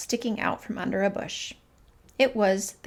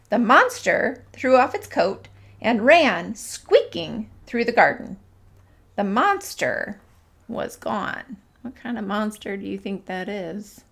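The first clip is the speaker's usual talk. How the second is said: ordinary delivery, nothing marked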